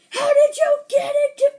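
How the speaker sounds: very high, very loud acted outburst; mad scientist shout. Mad scientist confronting the raccoon intruder, angry high shout. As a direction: shriek it like a furious cartoon scientist. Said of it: "mad scientist was like".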